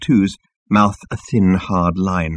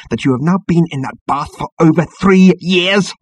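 high, indignant outburst; exasperated, raised-pitch complaint; seething reminder; 8 semitones higher, 4 dB louder than usual. A narrator's reading. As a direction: build up in outrage at your superior. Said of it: "hissed number two at the captain".

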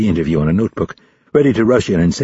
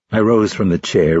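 ordinary delivery, nothing marked

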